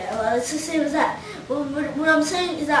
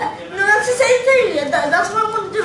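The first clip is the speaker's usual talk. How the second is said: laughing; 7 semitones higher, 5 dB louder, faster than usual. Real, not acted. Kid laughing defensively.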